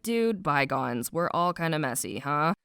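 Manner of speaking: low, soft, laid-back brush-off; casual forgiveness. Acted. Farley answering Cal's apology, low and laid-back. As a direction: wave it away quietly, pitch dropped.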